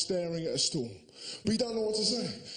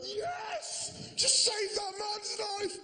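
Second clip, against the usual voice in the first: much higher, slow jubilant shout; crowd cheering; much slower than usual. Retold or imitated. Onlookers cheering the old fella, high shouted joy. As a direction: cheer like you won the cup. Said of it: "We were going".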